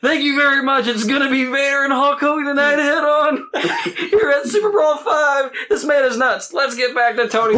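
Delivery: hammed-up announcer tossing to Tony. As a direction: wrap up like a hyped commentator. Playing announcer closing segment.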